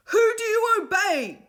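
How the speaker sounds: high, slow loud demand; boy demanding obedience from the children. A narrator's reading. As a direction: press the crowd for an answer. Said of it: "demanded Will".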